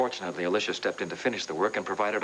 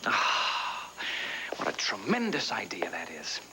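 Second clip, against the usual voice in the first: 1 sarcastic whisper; slower than usual. Acted. Sarcastic reply to Gabrielle's request.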